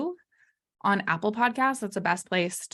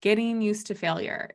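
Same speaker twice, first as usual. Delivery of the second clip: normal talking voice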